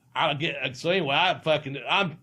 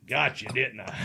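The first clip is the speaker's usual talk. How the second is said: quoting Scott; 5 semitones lower than usual. Retold or imitated. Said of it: "He looks over at me and goes".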